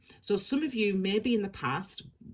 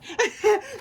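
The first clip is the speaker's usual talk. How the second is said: a burst of high laughter, louder; high bright laugh; 7 dB louder than usual. Real, not acted. Reader laughing to viewers in delight at a happy answer.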